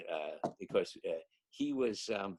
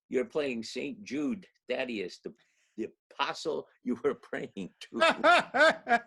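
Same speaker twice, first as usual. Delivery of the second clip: slow, drawn-out reveal, rising higher and louder with a laugh; punchline reveal; 5 semitones higher, 7 dB louder, slower than usual. Retold or imitated. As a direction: land the irony slowly, laughing at the end.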